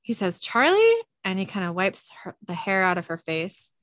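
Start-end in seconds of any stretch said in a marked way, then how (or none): 0.4-1.0 loud, high call of her name; calling a fallen friend. Retold or imitated. Dean calls to unconscious Charlie.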